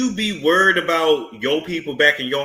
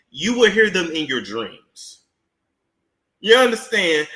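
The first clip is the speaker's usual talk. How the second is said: ordinary delivery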